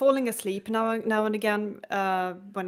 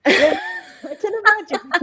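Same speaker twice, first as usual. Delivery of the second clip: laughing; 14 semitones higher, 10 dB louder than usual. Real, not acted. Laughing at a friend's remark.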